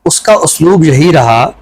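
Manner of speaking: slow, measured reading of a free verse poem; poem recitation. Acted. Host asked for a poem, he recites it slowly. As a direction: let each line land with a pause.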